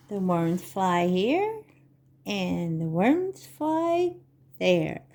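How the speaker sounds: slow, sing-song picture-book reading; Vorlesen. Acted. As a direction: read to little children, stretching the words with big pauses. Reads picture book to children.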